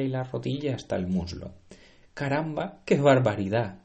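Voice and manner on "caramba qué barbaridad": loud startled exclamation; shocked shout. Acted. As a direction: blurt it out in alarm. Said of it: "gritó".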